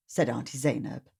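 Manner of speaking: ordinary delivery, nothing marked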